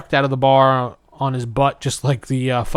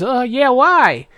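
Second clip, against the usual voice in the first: nervous Presto; 10 semitones higher, 4 dB louder, slower than usual. Retold or imitated. Presto voiced in retelling.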